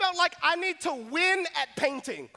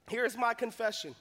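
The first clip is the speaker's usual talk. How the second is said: hushed repeat; 5 semitones lower, 6 dB quieter than usual. Shown as a demonstration. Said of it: "And so here's my confession".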